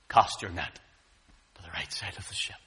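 very low voice fading to whisper; quiet instruction. Acted. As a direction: drop to a near-whisper. The Lord quietly instructing fishermen.

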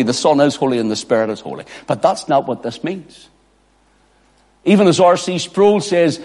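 normal talking voice

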